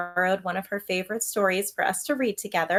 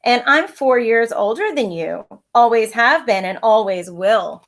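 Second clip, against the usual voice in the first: a touch of a sneer, a bit louder; 2 semitones higher, 10 dB louder than usual. Acted. Older brother sneering at his little sister, mild sneer. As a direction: gloat down at her. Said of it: "he sneered".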